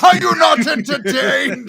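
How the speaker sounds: high, loud mock arena cry through a laugh; laughing gladiator shout. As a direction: roar it back at him, laughing. Echoing friend's film line.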